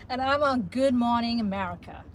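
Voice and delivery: loud, overdone Texan-style American; hammed-up Yellowstone American; bad American accent. Shown as a demonstration. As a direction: belt out American host with a Texas twang. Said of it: "What are you, a cast of Yellowstone?".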